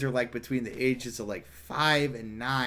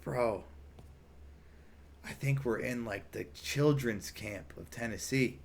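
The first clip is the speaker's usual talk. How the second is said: whispering; 11 dB quieter than usual. Retold or imitated. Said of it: "whispering to me kinda".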